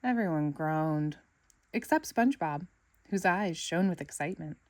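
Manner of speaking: plain reading voice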